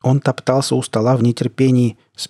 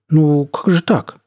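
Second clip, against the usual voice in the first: slow, higher indignant protest; 5 semitones higher, 3 dB louder, slower than usual. Acted. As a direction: protest it slowly, hurt and offended. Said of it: "снова возмутилась лаборантка".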